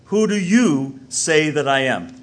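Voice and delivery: slow question with "you" drawn out emphatically; stress on YOU. Shown as a demonstration. Preacher showing the plural emphatic question, stress on YOU. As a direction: stretch and weight the word "you". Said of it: "it's emphatic".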